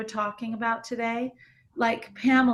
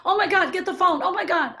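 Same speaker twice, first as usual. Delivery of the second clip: high, louder mock panic over mom's stroke; frantic "oh my God" as if losing it; panicking; 7 semitones higher, 4 dB louder, faster than usual. Imitating herself panicking when mom had a stroke. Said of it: "I didn't go off like".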